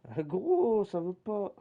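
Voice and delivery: loud, high cry of annoyance; frustrated outburst at the game; frustrated shout. Real, not acted. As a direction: yelp at the broken button.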